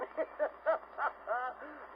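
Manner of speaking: high string of short chuckles; a storyteller's high, staccato laugh. Acted. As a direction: chortle high at your own joke. Laughs at his own joke.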